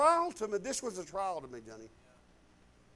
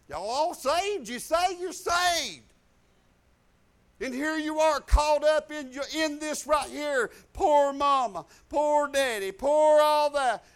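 slow, louder, higher scolding of the family; exasperated rant; 6 semitones higher, 9 dB louder, slower than usual. Retold or imitated. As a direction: scold them like a fed-up preacher. Pastor voices his own exasperated rant.

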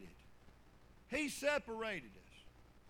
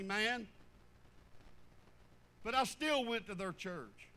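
ordinary talk, nothing marked

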